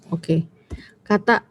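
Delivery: ordinary talk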